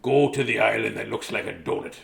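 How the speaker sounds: ordinary delivery, nothing marked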